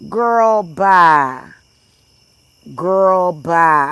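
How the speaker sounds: loud, sassy brush-off; dismissive. Real, not acted. Vlogger dismissing chat commenter, loud sassy brush-off. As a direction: wave them off loudly.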